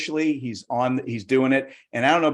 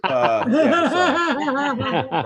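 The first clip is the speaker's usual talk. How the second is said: dog voice; 11 semitones higher, much slower than usual. Acted. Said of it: "dog answers".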